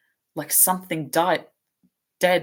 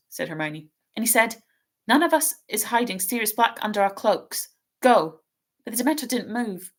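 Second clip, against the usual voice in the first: lightly voiced schoolgirl, higher and hurried in distress; a nervous young girl stumbling over her words; anxious girl's voice; 7 semitones higher than usual. Hermione's voice in reading.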